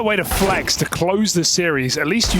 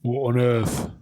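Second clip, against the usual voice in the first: mock British accent; 7 semitones lower, 3 dB quieter than usual. Joins the British accent joke.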